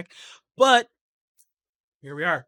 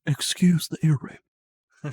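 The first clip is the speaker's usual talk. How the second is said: Sean Connery knockoff voice changer; radio voice through a voice changer; 3 semitones higher, 11 dB louder, much slower than usual. Real, not acted. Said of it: "Sean Connery knockoff voice changer radio voice".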